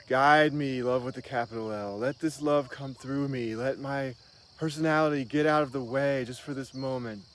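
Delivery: a lightly voiced heartfelt prayer; earnest prayer. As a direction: wellness guru praying aloud to love, earnest and lifted. Prays aloud to higher love.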